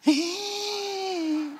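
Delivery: long, high squealing gasp; exaggerated shrill intake of breath; horrified gasp. Preacher acting the shock of the crow news, high gasp. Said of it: "cuando le dicen a uno una cosa así".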